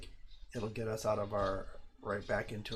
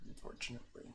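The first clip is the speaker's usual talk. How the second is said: ordinary talk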